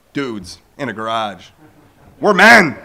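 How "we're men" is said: loud, punchy statement; emphatic declaration. Real, not acted. Preacher telling the crowd that men don't cry, loud and emphatic. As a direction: hit it hard and proud.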